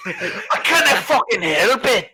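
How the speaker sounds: hammed-up Scottish accent. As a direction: drag it out in a helpless Scottish brogue. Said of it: "stuck in a fucking Scottish accent again".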